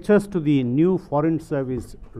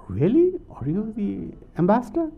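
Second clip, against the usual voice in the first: high-pitched incredulous question; mock astonishment; 8 semitones higher than usual. Passenger questioning the supposed ambassador, mock astonishment. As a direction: pitch jumps way up, acting amazed.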